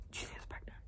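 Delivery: hushed whispered realization. Real, not acted. Reader realizing the news, whispered. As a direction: whisper it to yourself in awe.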